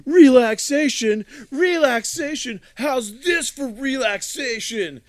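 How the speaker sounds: high, drawn-out mocking cry; over-the-top, high-pitched sarcastic rant; mock freak-out. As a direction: stretch each word high, as if ranting while trashing a room. Mocking a rampaging game character.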